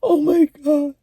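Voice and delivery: high, stunned oh my God; shocked gasp. Real, not acted. Reacting to a video, high and shocked. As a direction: react in disbelief at what you saw.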